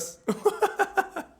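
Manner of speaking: high, loud bursts of laughter; laughing. Real, not acted. Streamer cracking up at his own remark, high laughter. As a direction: crack up in high staccato peals.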